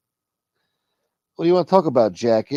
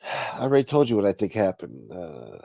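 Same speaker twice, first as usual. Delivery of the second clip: ordinary talk, nothing marked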